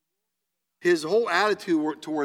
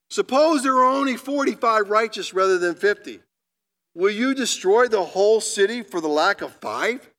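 higher and louder, earnestly pleading; pleading Abraham; 6 semitones higher, 5 dB louder than usual. Acted. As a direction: bargain humbly but urgently, as Abraham before God. Abraham bargains with God.

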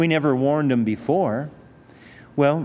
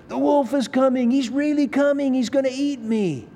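put-on boy crying wolf; 9 semitones higher than usual. Boy's final real cry.

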